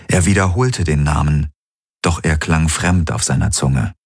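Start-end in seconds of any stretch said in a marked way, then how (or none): none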